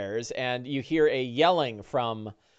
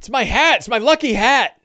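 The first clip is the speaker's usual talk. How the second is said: higher, louder insisting; indignant rancher; 9 semitones higher, 6 dB louder than usual. Acted. Big Al insisting to the heroes about his lucky hat, high and loud. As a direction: make it obvious the hat matters.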